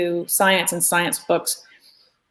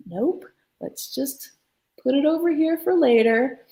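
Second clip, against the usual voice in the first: gentle intervention; 8 semitones higher, 3 dB quieter than usual. Acted. Studio friend stopping a frustrated artist, gently coaxing.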